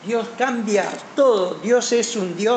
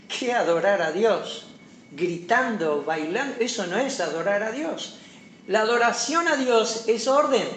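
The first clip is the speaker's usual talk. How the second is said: usual speaking voice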